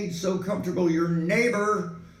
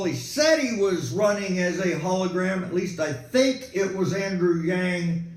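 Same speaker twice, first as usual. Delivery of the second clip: usual speaking voice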